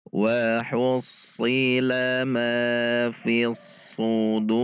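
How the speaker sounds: slow drawn-out chanted recitation; Quran recitation. Shown as a demonstration. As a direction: recite it melodically, holding each vowel long. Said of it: "Kita baca sekali lagi".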